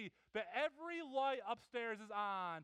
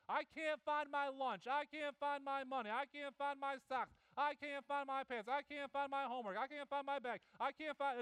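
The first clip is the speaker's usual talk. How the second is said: put-on whiny kid; 6 semitones higher than usual. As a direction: whine like a child who can't find anything. Kids listing lost things.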